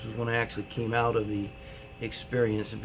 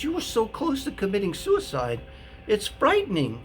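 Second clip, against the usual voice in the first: high, alarmed cry of horror; alarmed therapist; 10 semitones higher than usual. Retold or imitated. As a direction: sound shocked and frightened for him. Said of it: "She said".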